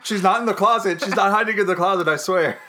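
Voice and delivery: playfully frantic denial; mock panicked pleading. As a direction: plead like a hammed-up horror victim. Acts contestants pleading with thriller villain.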